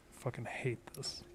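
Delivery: hushed frustrated whisper. Real, not acted. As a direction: whisper it under your breath. Frustrated whisper while gaming.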